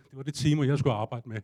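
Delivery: normal talking voice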